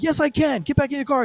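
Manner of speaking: high-pitched, heated comeback; acted insistence. Cop contradicting driver in driveway, acted heated insistence.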